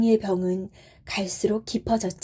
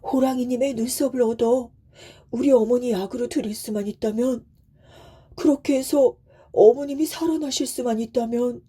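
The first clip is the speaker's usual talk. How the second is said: very polite, heartfelt begging; earnest pleading; 3 semitones higher than usual. A narrator's reading. Son begs tiger for eyebrows, pleading earnestly. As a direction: plead humbly for mother's life. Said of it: "아주 아주 간곡하게 말이에요".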